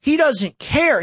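high, indignant repeat of He doesn't care; rising disgust hammered twice; outraged. Real, not acted. Ranting to viewers about the lawyer, high and outraged.

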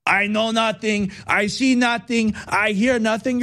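lightly voiced, slow bumbling guard; a hint of a dim camp guard pleading ignorance, slowly; Sergeant Schultz. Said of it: "You're acting like Sergeant Schultz".

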